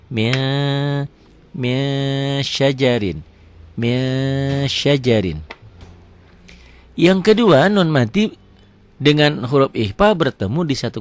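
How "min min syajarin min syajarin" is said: "min" drawn out as a long "ming" hum; held nasal. Shown as a demonstration. Teacher repeats that it is held, "min" as long "ming". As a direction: hold the ng of "min" steady before "syajarin". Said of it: "ditahan juga ada, ditahan".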